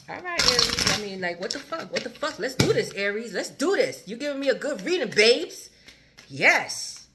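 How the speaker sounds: loud, high, thrilled cheering; hyped up. Real, not acted. Reader hyping the client's reading, loud and high. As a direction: fire yourself up over the cards.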